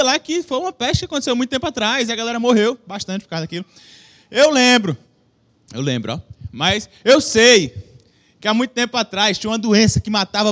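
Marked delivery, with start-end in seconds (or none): 4.3-5.0 loud, emphatic declaration. Shown as a demonstration. Preacher claims to remember the history lesson, loud and emphatic. 5.7-6.2 much lower, offhand repeat; low-pitched aside. Shown as a demonstration. Preacher repeats his claim to the listeners, as a low aside.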